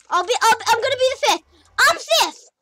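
high, loud, rapid excited claim; excited shouting. Real, not acted. Kid gamer claiming fifth place, shouting excitedly. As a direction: blurt it out at the top of your voice.

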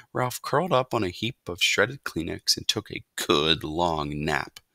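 ordinary delivery

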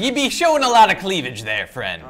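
loud, high hammy pirate. Acted. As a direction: belt it like a cartoon pirate. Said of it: "I not be liking the non-pirate voice".

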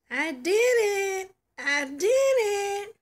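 proud little cheer; triumphant. Acted. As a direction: savour the victory. Drawer finally opens.

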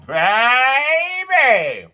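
high, drawn-out excited call. Real, not acted. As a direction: sing it out in delight. Hyping The Voice's return.

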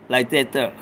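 low, soft "that there", "there" turned to "thurr"; "there" said "thurr", pitched low; "that there" as slang. Shown as a demonstration. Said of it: "You gotta say it like that there".

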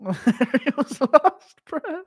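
high, drawn-out words through laughter; can barely get it out for laughing; helpless laughter. Real, not acted. Cracking up at imagined scene.

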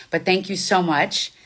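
usual speaking voice

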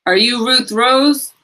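villain woman voice. Acted. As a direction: play the crooked woman, demanding. Villain woman questions Ruth Rose.

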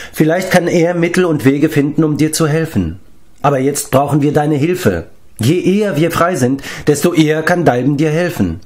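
high-pitched, earnest persuasion; earnest young hero Taran. Acted. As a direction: reason with the giant, urgently and encouragingly. (eindringlich überredend)

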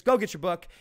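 ordinary delivery

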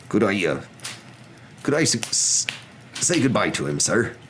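stammering, tentative request; halting Hagrid. A narrator's reading. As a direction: falter as you ask a favour. Hagrid asking to say goodbye.

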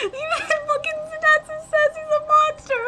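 very high, half-laughing squeal; giddy high-pitched yelling; ecstatic amazement. Real, not acted. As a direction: squeal it like a thrilled fan. Reacting to hero's success.

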